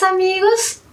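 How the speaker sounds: ordinary delivery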